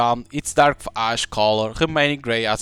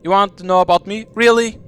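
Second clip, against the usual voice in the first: higher, louder and quick, lit up with delight; 8 semitones higher, 5 dB louder than usual. Acted. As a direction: bubble over because someone finally asked. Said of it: "Lily's face lights up".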